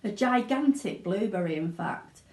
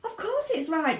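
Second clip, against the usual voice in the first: put-on higher bratty girl's voice; Violet; 9 semitones higher than usual. Violet contradicts Mr Wonka, cocky and bratty. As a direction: brush him off, cocky. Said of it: "said Violet".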